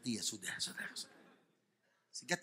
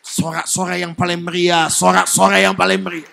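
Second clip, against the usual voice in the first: raised, full-volume preacher's roar; rousing shout; 5 semitones higher, 21 dB louder than usual. Real, not acted. Preacher calling the congregation to cheer, roaring shout. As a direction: whip the crowd into cheering.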